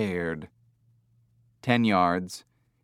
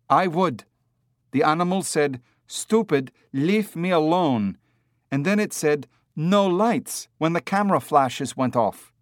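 put-on higher, pressing accented man; insistent tourist; 6 semitones higher than usual. A narrator's reading. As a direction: insist on what was heard, pitched up. Heavy-set man insists, confronting Hager.

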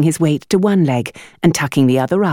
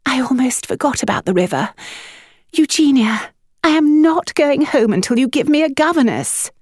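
high, insistent plea; despairing mother; 11 semitones higher than usual. A narrator's reading. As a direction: refuse to budge. Winifred despairing.

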